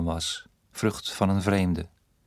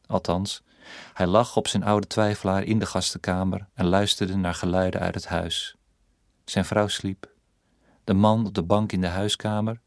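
plain reading voice

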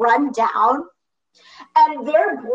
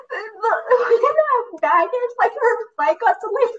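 high, half-laughing mock sob; retold sobbing; 10 semitones higher than usual. Telling dad about the lost baggage, a laughing mock sob. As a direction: imitate her own teary voice while laughing. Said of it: "sobbing on the phone".

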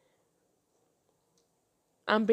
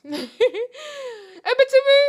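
long, high playful shout; calling out; 14 semitones higher, 9 dB louder, much slower than usual. Pretend. Host jokingly summons Ebitimi for help, calling out. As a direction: call the name out loud, drawn out. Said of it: "Ebitimi, I'm calling you".

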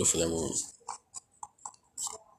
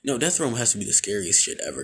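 usual speaking voice

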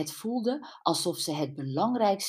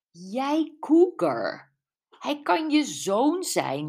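slow, high, drawn-out tease; teasing; 7 semitones higher, 4 dB louder, much slower than usual. A narrator's reading. Jules ribbing Brenda about a younger man, teasing. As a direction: stretch out the mock accusation playfully. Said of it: "Jules stompte Brenda plagend".

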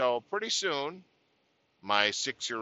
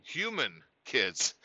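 normal talking voice

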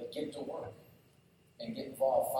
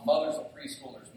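usual speaking voice

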